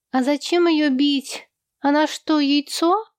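put-on high, playful child's question. A narrator's reading. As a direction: ask it impishly in a little voice. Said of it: "шаловливо".

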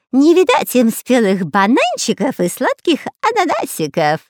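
high, loud, swooping character voice; babbling villain. A narrator's reading. Spy taunts captives about hungry children, babbling mockingly. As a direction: babble mockingly with wild pitch swings. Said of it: "бормотал Дырка".

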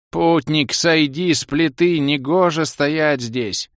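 high, slow cracked puppet voice; cracked doll voice. A narrator's reading. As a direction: drone it like a broken talking toy. Doll repeats warning again.

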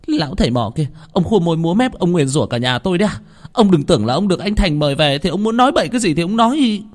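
high, loud shrill-tongued scolding; aunt berating the shaman; angry aunt. Acted. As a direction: tear into the old shaman.

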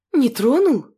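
incredulous question; surprised. Acted. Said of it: "Удивилась Нинель".

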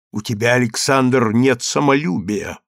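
usual speaking voice

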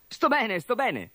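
put-on high boyish voice calming them; young orc insisting he is fine; reassuring young orc. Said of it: "li rassicurò Gorgo".

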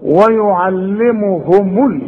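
ويعلمهم with the meem carried on a damma, said slowly; ويعلمهم with a slow voiced meem on damma. Shown as a demonstration. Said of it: "فضمها لي عند الوصل".

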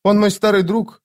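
put-on high girlish voice; young woman's voice. A narrator's reading. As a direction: speak high, as the girl. Girl's line in narrated story. (высокий девичий голос)

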